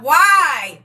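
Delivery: loud, drawn-out mock-angry demand; retold mad mother. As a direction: imitate an exasperated mother, loudly. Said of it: "She used to be so mad".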